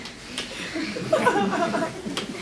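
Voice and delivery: a bright little giggle of delight; light giggle. Real, not acted. Delighted girl chattering in morning.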